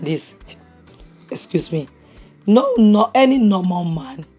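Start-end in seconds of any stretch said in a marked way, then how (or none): none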